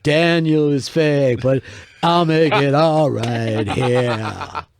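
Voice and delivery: slow crooning showman bit; a light Vegas crooner take. Acted. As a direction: croon it out like a lounge star in sunglasses. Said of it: "went into my fucking [name]".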